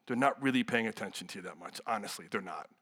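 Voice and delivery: low, softened, frank aside; hushed confiding. Real, not acted. Preacher levelling with students about attention, hushed.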